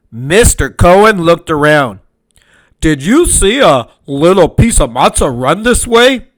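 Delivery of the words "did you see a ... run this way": lightly voiced baker, puzzled question; baker's voice. Mister Cohen searching the room, puzzled baker voice. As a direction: ask around like a suspicious baker. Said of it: "he asked".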